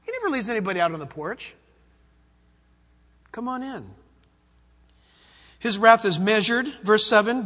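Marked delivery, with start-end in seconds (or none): 3.3-3.9 soft invitation. Acted. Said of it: "repeating the invitation softly".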